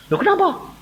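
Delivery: high-pitched puzzled query; surprised question. Acted. Sister asking brother about his amen, surprised. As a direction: leap up in pitch, taken aback.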